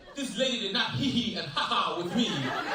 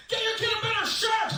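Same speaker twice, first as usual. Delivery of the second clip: high, indignant exclamation; mock scolding; 11 semitones higher than usual. House guests seeing the child's picture, mock indignant scolding. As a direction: imitate an appalled visitor calling him out. Visitors scolding him over fridge photo.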